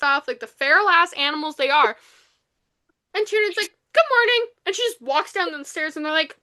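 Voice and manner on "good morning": high, lilting good-morning call; cheery greeting. Retold or imitated. Retelling her surprise entrance at breakfast, chirpy. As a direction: sing it out brightly, pitched way up.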